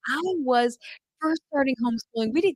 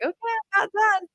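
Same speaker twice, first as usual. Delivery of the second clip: put-on bored child; 10 semitones higher than usual. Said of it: "he's like".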